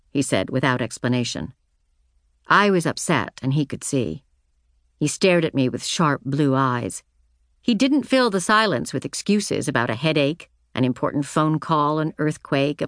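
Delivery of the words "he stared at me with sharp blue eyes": ordinary delivery, nothing marked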